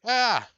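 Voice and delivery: high, loud imitated scream; mock scream. Little boy imitating his own scream, loud high. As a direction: shriek like a scared kid. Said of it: "I was like".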